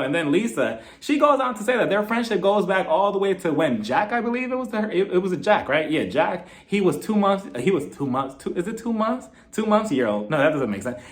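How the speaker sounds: ordinary delivery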